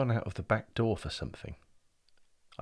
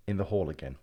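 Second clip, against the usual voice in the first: usual speaking voice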